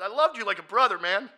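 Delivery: high, very loud hurt anger; angry outburst. Retold or imitated. Yelling at a friend like a brother, angry shout. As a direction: shout it at him as a wronged friend. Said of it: "I just got angry".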